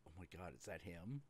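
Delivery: hushed fast murmur; awed aside. Retold or imitated. Fan realizing his hero is right there, hushed quick murmur. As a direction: gasp it under your breath, starstruck. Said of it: "I'm like".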